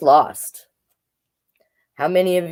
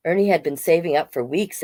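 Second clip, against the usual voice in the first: ordinary delivery, nothing marked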